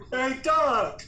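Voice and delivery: loud high call to get his attention; yelling across the room. Real, not acted. Repeat call, louder.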